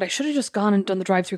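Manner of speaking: normal talking voice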